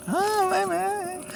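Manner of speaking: high, slow, awestruck fanboy sigh; starstruck fanboy gush. Retold or imitated. Drunk fan to his hero, high and slow. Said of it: "I went".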